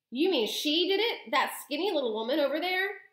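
high, incredulous shouted question. A narrator's reading. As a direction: raise your voice in disbelief. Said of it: "shouted Mister Jenkins".

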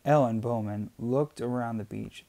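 ordinary delivery, nothing marked

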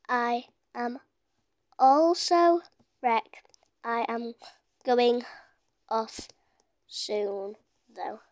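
halting, word by word, low and soft; typing aloud. Real, not acted. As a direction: leave a gap after each word while typing. Sounding out words while typing.

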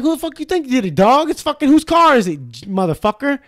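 high-pitched incredulous mock rant; worked-up imitation of being fed up; exasperated retort. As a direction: retell it as an impatient comeback. Retold exasperated answer.

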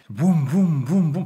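loud, high vroom noises; high mouth-made car noise; imitated engine revs. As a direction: rev it with your voice like a roaring engine. Imitating an engine revving in the story.

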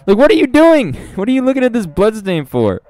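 high, loud, amused disbelief; incredulous outburst. Pretend. Streamer questioning the man staring at blood, incredulous.

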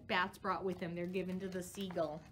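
normal talking voice

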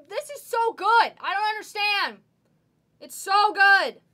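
loud, high rave over a card; thrilled. Real, not acted. Card breaker raving at a great pull, loud and excited.

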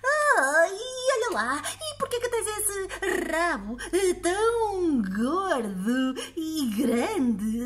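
put-on high old lady, laughing as she asks; giggling old woman. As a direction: tease him like a cheeky granny.

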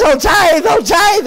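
high, loud, desperate cry; panicked plea. Retold or imitated. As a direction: beg to be let back in the car. Panicked helper begs in dark.

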